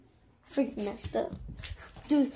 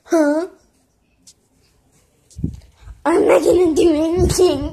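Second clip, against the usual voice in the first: mock shout; 3 semitones higher, 10 dB louder than usual. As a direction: yell it in mock defiance.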